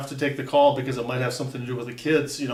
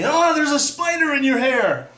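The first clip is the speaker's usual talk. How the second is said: high, loud, drawn-out mock warning; kid's alarm; 12 semitones higher, 8 dB louder than usual. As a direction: holler it like a kid raising a false alarm. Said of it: "some kid telling me".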